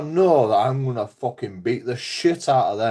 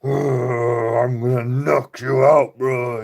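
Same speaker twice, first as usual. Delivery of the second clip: slow, louder tough-guy threat; mock tough threat; 4 dB louder, much slower than usual. Owner acting out his warning to the dog, slow mock menace. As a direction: draw it out like a hard man squaring up.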